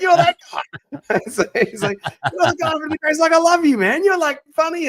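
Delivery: very high-pitched imitation, laughter breaking in; mock delighted recognition. Acting delighted player, laughing through story.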